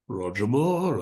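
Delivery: deep-voiced film star impression; demonstrating a deep, suave star's voice; Roger Moore. Shown as a demonstration. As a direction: drop into a famous actor's deep voice. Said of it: "If it's a particularly deep voice like".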